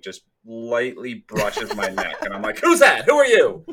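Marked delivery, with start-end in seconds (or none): 2.6-3.6 high, loud mock alarm; startled yelp. Podcaster mimics jumping at own shirt tag, startled. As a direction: jump and demand it in exaggerated panic.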